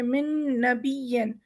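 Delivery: مِن نَبِیٍّ slowly with one held nasal noon; slow idgham demo; doubled noon. Shown as a demonstration. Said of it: "پچھلے والے نون کی آواز نکالیں گے".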